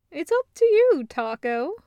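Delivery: high, loud, earnest reassurance; Loop, caring. Acted. Loop telling Taco it's his choice, high and earnest. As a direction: lift it up and lean in kindly. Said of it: "she says".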